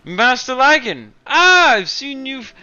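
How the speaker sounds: high, slow eager servant voice; servant Boblin. Acted. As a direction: greet the master sweetly, drawn out.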